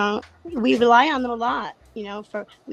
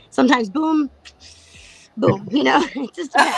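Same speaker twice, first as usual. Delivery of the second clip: laughing; 3 semitones higher, 6 dB louder than usual. Real, not acted. Laughing at her boom boom.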